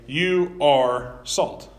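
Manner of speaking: You are salt said very slowly, You stressed; deliberate slow You are salt; slow emphatic You. Shown as a demonstration. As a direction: spell it out word by word, pressing You. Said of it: "the word "you" is emphatic".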